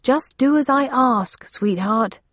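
calling upstairs. Acted. Still shouting up to husband.

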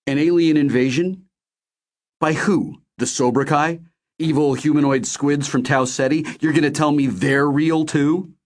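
high-pitched, disbelieving questions; incredulous. A narrator's reading. Narrator questions Ray about aliens, incredulous. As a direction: throw it back as a sceptical challenge.

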